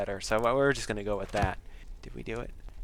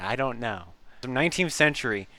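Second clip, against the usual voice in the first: usual speaking voice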